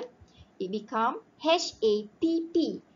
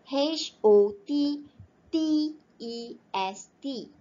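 slow spelling; 4 semitones higher, slower than usual. Shown as a demonstration. Teacher spelling hottest for students, slow and deliberate. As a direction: spell each letter out slowly and clearly. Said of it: "teacher spells out the word".

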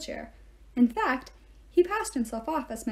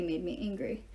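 usual speaking voice

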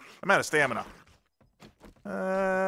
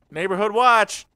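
loud, higher-pitched shout at the door; playful announcing call; 8 semitones higher, 7 dB louder, slower than usual. Pretend. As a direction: announce yourself loudly to the house. Announces self at the door in play.

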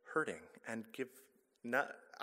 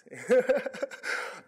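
loud laugh after a slip; high, loud burst of laughter; embarrassed laugh; 15 dB louder than usual. Real, not acted. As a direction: laugh off losing the thread. Laughs after losing her place.

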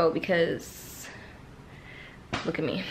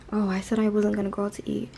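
ordinary talk, nothing marked

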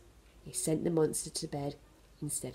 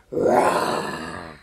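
much lower loud growling roar; monster roar; 13 semitones lower, 7 dB louder than usual. Acted. Monster roars behind Bernard's mother to scare her. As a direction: roar to give a fright.

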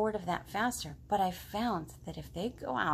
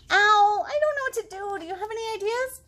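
high, pleading little frog voice; whiny frog; 12 semitones higher, 9 dB louder than usual. Acted. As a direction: whine it up high like a bored frog. Frog asks owl.

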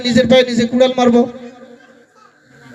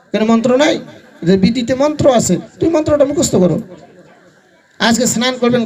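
normal talking voice

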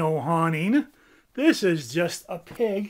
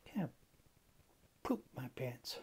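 embarrassed whisper; 5 semitones lower, 14 dB quieter than usual. Acted. Bird whispering an embarrassing accident. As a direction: confess under your breath.

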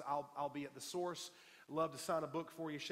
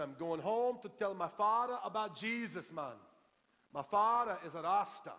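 imitated Jamaican accent, raised and loud; 2 semitones higher, 5 dB louder than usual. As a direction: quote the friend in an emphatic Jamaican accent. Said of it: "in that Jamaican accent".

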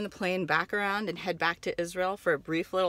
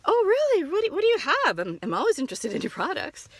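gushing customer; 11 semitones higher, 4 dB louder than usual. Acted. Naive customer answering seller.

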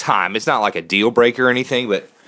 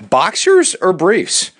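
slow, high quiz-host question; game show host; 8 semitones higher, slower than usual. Acted.

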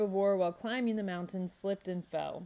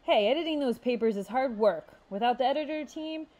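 proud; 5 semitones higher, 3 dB louder than usual. A narrator's reading. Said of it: "Chess said proudly".

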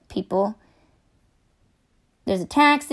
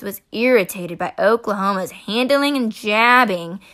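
ordinary delivery

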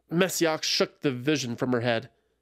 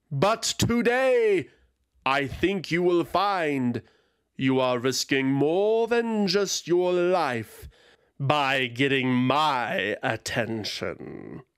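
menacing dragon; slower than usual. Acted. Dragon gloating over her weapon at Zradka. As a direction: savour every word of the threat.

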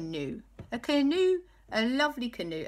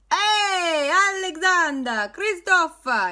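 hammed-up Italian accent, louder and higher; 12 semitones higher, 10 dB louder, slower than usual. El Fuego greets the boys in a hammy Italian accent. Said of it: "He's turned Italian".

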